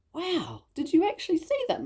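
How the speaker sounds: high-pitched wonder, acted out; amazed. Mum hearing her daughter saw angels, amazed. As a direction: re-enact a mum's astonished reaction.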